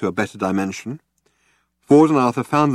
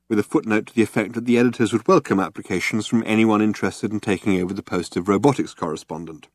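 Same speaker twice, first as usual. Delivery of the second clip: ordinary delivery, nothing marked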